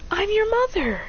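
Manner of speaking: high, feminine put-on voice; high-voiced mother figure; mother's revelation. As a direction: announce it high and emotional. Mother reveals herself to son.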